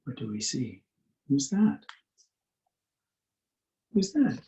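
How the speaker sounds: usual speaking voice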